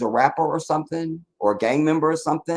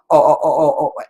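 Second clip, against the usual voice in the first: louder breathless oh's trailing off; mock swoon; 4 semitones higher, 7 dB louder than usual. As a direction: gush over her beauty, overcome. Swooning over Michelle Obama.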